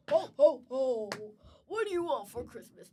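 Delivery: loud booming Santa ho ho ho; be a jolly mall Santa. Retold or imitated. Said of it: "he'll be like".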